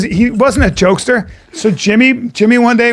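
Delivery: normal talking voice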